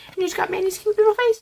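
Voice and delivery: high, quick coo over a cute photo. Real, not acted. Vlogger cooing at the photo on the box. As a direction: gush at the little face.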